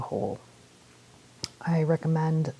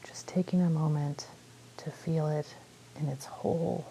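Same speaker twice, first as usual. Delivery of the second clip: calm meditation guide with long pauses; unhurried phrases, leaving space to breathe; guided meditation; 8 dB quieter than usual. Real, not acted. Guiding listeners through a body scan, calm meditation voice.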